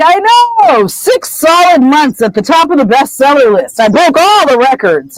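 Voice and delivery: high-pitched, crowing boast; gushing self-congratulation. Acted. Lockhart brags to Harry about his bestseller, gushing. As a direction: revel in your own success, pitched well up.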